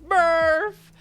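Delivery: loud, high hammed-up wail; mock screaming Murph. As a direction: yell it like the movie scene. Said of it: "We were screaming".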